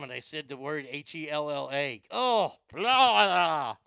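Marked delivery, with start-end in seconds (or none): 2.1-3.8 loud gagging blah blah; disgusted spitting blah. Real, not acted. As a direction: spit out the bad word in disgust. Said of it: "Wash out my mouth".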